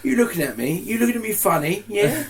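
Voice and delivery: high, rising mock-aggressive Pompey challenge; Pompey accent. Shown as a demonstration. Portsmouth native plays a local hard case, Pompey accent. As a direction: pick a fight in hammed-up EastEnders-style Pompey. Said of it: "slip into a little bit of what we call Pompey".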